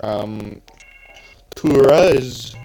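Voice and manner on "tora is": slow, louder Swedish accent. Shown as a demonstration. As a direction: say Tora the Swedish way. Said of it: "a stereotypical Swedish accent".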